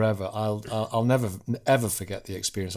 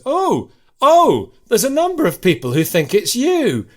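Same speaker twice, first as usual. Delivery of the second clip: excited; 10 semitones higher, 7 dB louder than usual. Retold or imitated. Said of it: "Kirsty goes".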